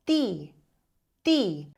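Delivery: ti said with the tongue between the teeth; teacher showing the tongue between the teeth; Italian ti. Shown as a demonstration. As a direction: model the dental ti. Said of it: "com a língua entre os dentes".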